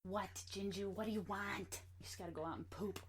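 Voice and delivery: quiet, soft pet talk; soft talk to dog. Real, not acted. Talking to her dog.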